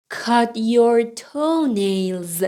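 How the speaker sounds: slow, drawn-out learner's model; slow model reading. Shown as a demonstration. Teacher repeats the books sentence for learners, slowly. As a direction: give each word room, slowly.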